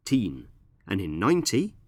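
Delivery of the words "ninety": ninety with the weight on NINE; stress on first syllable. Shown as a demonstration. Teacher contrasting ninety with nineteen, stressed on NINE. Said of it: "we stress the first syllable".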